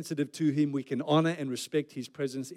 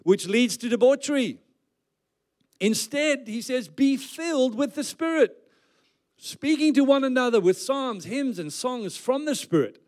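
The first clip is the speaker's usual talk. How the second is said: raised, measured Bible reading; proclaimed scripture; 4 semitones higher than usual. Acted. Preacher reading Paul's words on being filled, proclaimed scripture. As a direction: declaim the verse phrase by phrase.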